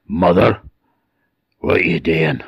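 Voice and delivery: rough, raspy creature voice; strange changeling voice. A narrator's reading. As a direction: rasp it eerily from the cradle. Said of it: "a strange voice from behind her".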